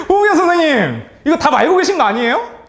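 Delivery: high, loud delighted call of a name; excited shout to the teacher. Real, not acted. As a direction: call her name out, thrilled. Calling out a quick answerer.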